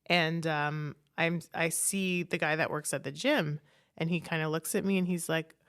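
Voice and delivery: ordinary talk, nothing marked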